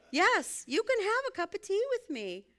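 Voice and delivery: high, loud, eager yes; delighted. Pretend. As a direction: burst with joy at the offer. Delighted answer to tea offer.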